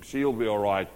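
low, casual Aussie aside; lightly put-on Australian accent. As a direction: say it with a hint of Aussie. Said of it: "a little bit of Aussie influence in him".

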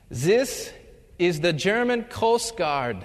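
put-on a lightly done German accent, formal on the radio; thick German accent. German recruit answers the radio in a thick German accent. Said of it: "in his thick German accent".

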